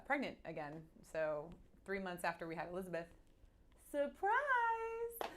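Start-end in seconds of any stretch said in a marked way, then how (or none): 3.9-5.3 high squealed surprise!; spring it like a party reveal; mock surprise. Telling the podcast host about her pregnancy, a high mock surprise.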